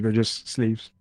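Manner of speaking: usual speaking voice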